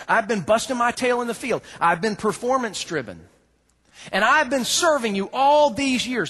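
put-on bitter older brother. Preacher voicing bitter older brother.

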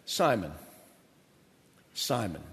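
hushed, low and sorrowful; low and soft like a sad friend. Acted. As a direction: drop to a near whisper, grieving. Jesus speaks gently, reminding of humanity.

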